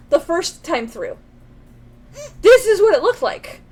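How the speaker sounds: loud, fast, high-pitched excitement; excited to her partner about the glitch; excited pointing out. Real, not acted. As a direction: show it off, delighted it's back. Excitedly showing the fixed scene.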